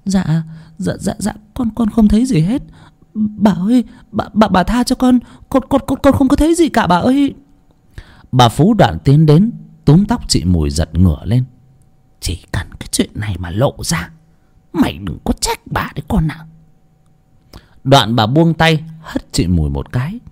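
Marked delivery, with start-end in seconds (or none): 0.1-7.3 high-pitched, terrified stammer; frightened servant's high stuttering plea; pleading. A narrator's reading. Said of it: "chị Mùi quỳ xuống van xin". 12.2-16.4 cold, hushed threat; soft, breath-only menace from the mistress; menacing whisper. A narrator's reading. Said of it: "lạnh giọng đe dọa".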